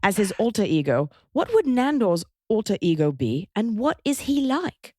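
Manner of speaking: posh British letter-reading; British RP accent. Shown as a demonstration. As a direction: read out fan mail in careful put-on RP. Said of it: "in a R P".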